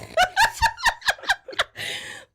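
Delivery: loud high-pitched laughter; squealing laugh. Real, not acted. Laughs at own slip.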